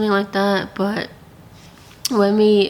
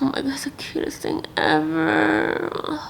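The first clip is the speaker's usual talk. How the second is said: softly whispered awe over a puppy; awed whisper; 5 semitones higher, 4 dB quieter than usual. Retold or imitated. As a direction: breathe it out as if afraid to wake him. Holding the puppy, retold awe.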